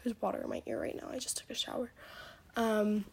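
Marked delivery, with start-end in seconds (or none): 0.0-1.9 whispered aside. Real, not acted. Girl with water in her ear adding a whispered aside. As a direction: toss it off in a whisper.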